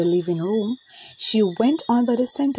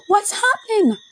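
high, louder startled cry; child's scream; 13 semitones higher, 4 dB louder than usual. Acted. Babsy turning into vapor, crying out high and startled. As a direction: shriek it as she turns to vapor. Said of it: "Babsy screamed".

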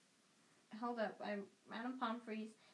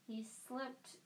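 plain reading voice

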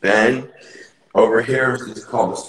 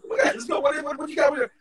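high-pitched imitation of noisy visitors; loud visitors; much faster than usual. Host imitating loud guests arriving, high excited chatter. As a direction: squeal it like excited arrivals, without real volume. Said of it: "they're loud".

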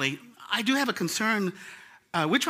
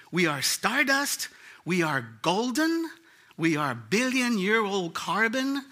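recited lyric; 3 semitones higher, slower than usual. Retold or imitated. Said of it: "The chorus says".

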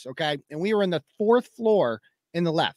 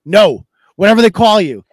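louder, flat dismissive refusal; the officer's annoyed brush-off again; repeated curt brush-off; 8 dB louder than usual. Retold or imitated. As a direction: shut him down like a bored official.

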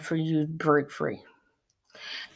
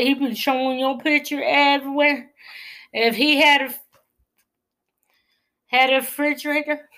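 excited preaching; 7 semitones higher than usual. Acted. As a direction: lift the room with joy. Preaching about God's love.

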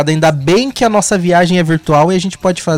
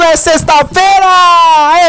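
slow, high shout rising at the end; rising hype call; 11 semitones higher, 6 dB louder, much slower than usual. Acted. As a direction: call it out like a crowd chant.

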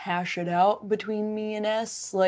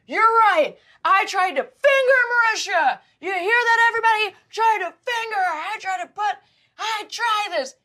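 much higher, louder mocking yell; mock shouting; 6 dB louder than usual. Ex pacing the floor, shouting to everybody in mockery. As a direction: shout it to the whole floor in ridicule. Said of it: "he started shouting".

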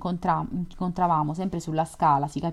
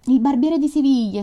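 grandmother cries out the title, higher and louder; excited exclamation; 7 semitones higher, 7 dB louder than usual. Acted. Grandmother exclaiming to the lodger, higher and louder. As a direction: burst out with delight at the opera's name. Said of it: "esclamò la nonna".

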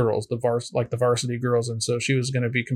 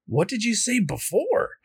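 curious coach; 9 semitones higher than usual. Retold or imitated. Coach voiced in retelling.

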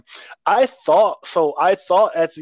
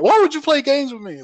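high, louder mock outburst; hammed-up wounded rage; imitating angry Roman; 13 semitones higher, 4 dB louder than usual. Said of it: "really upset and was like".

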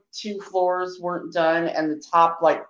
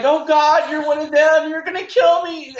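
high, loud panicked imitation; mock freaking out; 10 semitones higher, 5 dB louder than usual. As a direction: act out a hammed-up panic, pitched way up. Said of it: "Alan Rickman starts freaking out".

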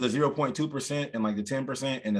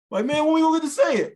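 high, loud mock whining protest; impatient complaint; 13 semitones higher, 7 dB louder than usual. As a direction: imitate someone fed up with waiting.

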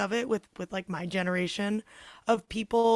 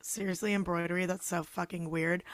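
mock scorn; 3 semitones lower, 8 dB quieter than usual. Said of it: "how lame is it for me to be like".

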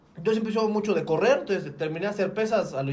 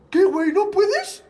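mocking gym bro; 11 semitones higher, 7 dB louder, slower than usual. Mocking cocky friend.

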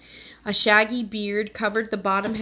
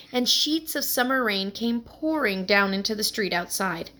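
ordinary delivery, nothing marked

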